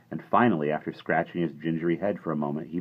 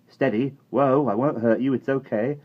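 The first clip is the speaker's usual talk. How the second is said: a bit higher and louder, calming; soothing like the cowboy; 8 semitones higher, 6 dB louder than usual. A narrator's reading. Omri calming the little man like the cowboy. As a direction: settle a frightened horse. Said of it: "saying like the cowboy himself".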